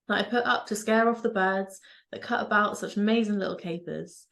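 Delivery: normal talking voice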